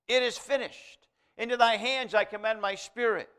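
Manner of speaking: high, loud ringing cry of the last words. Retold or imitated. Jesus on the cross, crying out loudly. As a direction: call it out with full voice. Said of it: "He said it in a loud voice".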